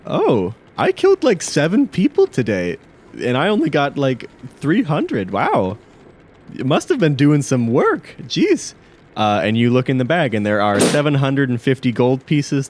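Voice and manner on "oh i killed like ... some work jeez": high-pitched, swooping character voice; higher voice reacting to someone else's big payout; Talia. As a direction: play a mildly amazed colleague, pitched well up. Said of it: "Talia goes".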